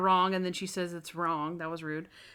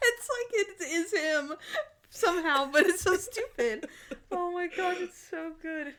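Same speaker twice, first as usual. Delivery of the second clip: laughing; 9 semitones higher than usual. Real, not acted. Still laughing at the sketch.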